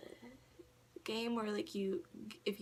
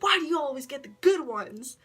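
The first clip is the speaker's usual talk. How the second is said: mock whine; 6 semitones higher, 7 dB louder than usual. Friend complaining jokingly.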